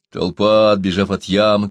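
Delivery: ordinary delivery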